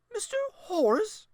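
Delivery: put-on high, shocked old lady cry; can't believe it was him; shocked grandmother. A narrator's reading.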